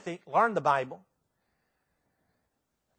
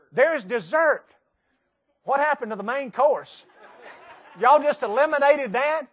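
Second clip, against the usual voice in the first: playfully incredulous, pitched higher; mock outrage; 6 semitones higher, 5 dB louder than usual. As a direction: protest the missing meals in jest. Mock outrage over cancelled meals.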